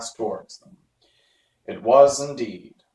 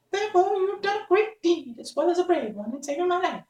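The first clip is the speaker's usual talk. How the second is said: much higher, tiny gracious squeak; mouse queen. Acted. Mouse queen thanks the Woodman, high tiny voice. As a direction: thank him like a small queen. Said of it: "added the Queen".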